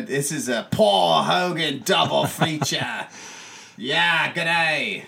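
hammed-up Aussie announcer; Australian accent. Host announces the double feature, mock Australian accent. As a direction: proclaim it in a playful, massacred Australian accent. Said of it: "massacring, um, uh, the Australian accents".

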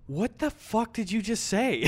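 exaggerated, high disbelief; shocked class. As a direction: imitate a scandalized classroom. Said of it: "the whole class going".

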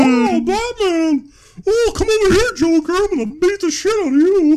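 a cartoon doofus hero hollering; dumb guy voice. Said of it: "a dumb guy voice".

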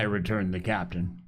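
ordinary delivery

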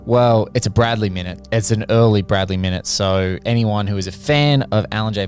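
usual speaking voice

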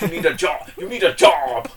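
a lightly done Vincent D'Onofrio Kingpin; Kingpin impression. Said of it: "this is how you do a Kingpin impression".

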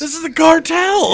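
high, loud exasperated mock yell. A friend yelling at Kyle to stop giving his address, high loud mock yell. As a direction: hammer him to shut up, loud and high. Same retold yell continues.

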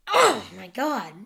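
loud startled shout; sudden loud outburst. Real, not acted. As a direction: blurt it out loud, caught off guard. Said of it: "Sorry, that was loud".

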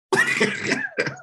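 a high, sudden laugh that falls away; burst of laughter. Real, not acted. Podcast host cracking up at his guest's studio.

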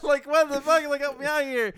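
high, loud, whiny pleading; desperate plea. Retold or imitated. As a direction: beg for help in a high wail. Retold desperate plea for help.

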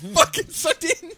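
high, slow mocking jeer. Teasing Callum about the intro, high mocking jeer. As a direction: rub it in with a high sneer. Mocking friend mid-banter.

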